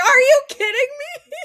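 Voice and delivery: high, loud disbelief; incredulous outburst. Retold or imitated. Driver reacts to teammate not going wide open, high loud disbelief. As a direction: blurt it out in pure shock. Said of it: "And I was like".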